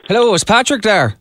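put-on a light Irish accent on a prank call. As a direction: play an Irish caller hunting for his brother-in-law. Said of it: "Jim is gonna do his best Irish accent".

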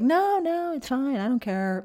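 high, breezy no-big-deal voice; dismissive brush-off. Retold or imitated. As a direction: wave it off like a careless teen. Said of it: "I was like".

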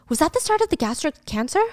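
ordinary delivery

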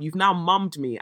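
usual speaking voice

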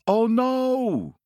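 high, loud, drawn-out cry of dismay; mock dismay. A narrator's reading. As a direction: wail it like a storybook bird in trouble. Jose discovers juice on his beak.

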